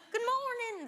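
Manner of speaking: high, badly faked Scottish greeting; horrible Scottish accent. Guest imitates passers-by greeting her, in a bad Scottish accent. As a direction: attempt a clumsy Scottish accent. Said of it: "That was a horrible Scottish accent".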